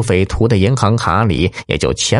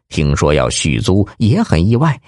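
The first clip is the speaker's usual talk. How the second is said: ordinary delivery, nothing marked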